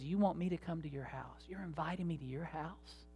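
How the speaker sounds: hushed, incredulous questioning; quietly stunned, voice dropped; retold disbelief. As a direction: imitate someone softly taken aback.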